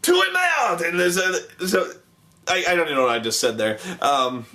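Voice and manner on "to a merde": very high, hammed-up French cry; garbled mock-French outburst, pitched way up; cartoon French commander exclaiming; Napoleon. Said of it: "he's like".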